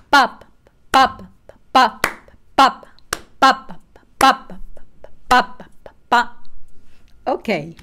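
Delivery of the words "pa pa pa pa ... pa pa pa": sharp loud pops on each accent; accented rhythm syllables. Shown as a demonstration. Teacher demonstrating accents to a piano student, loud pops. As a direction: bark the rhythm, accents strong. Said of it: "we will make the pulse in quarter notes".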